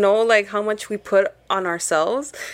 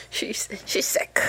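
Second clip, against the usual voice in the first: quiet whispered aside; whispering; 6 dB quieter, slower than usual. Real, not acted. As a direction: whisper it slowly. Aside about sick friend.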